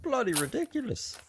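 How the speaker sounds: high-pitched put-on British voice; mock British accent. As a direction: attempt Canadian, land on a silly British accent. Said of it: "it sounds more British to me".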